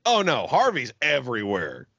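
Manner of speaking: ordinary talk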